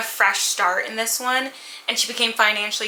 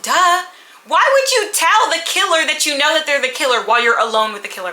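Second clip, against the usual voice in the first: higher and louder incredulous rant; exasperated scolding; 6 semitones higher, 6 dB louder than usual. Pretend. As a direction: scold her for tipping off the killer. Scolding the heroine's bad choice.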